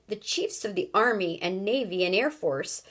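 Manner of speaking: usual speaking voice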